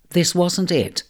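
ordinary delivery, nothing marked

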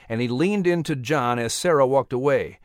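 ordinary delivery, nothing marked

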